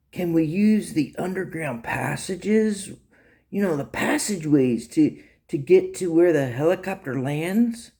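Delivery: lightly acted eager question; lightly voiced Jeremy.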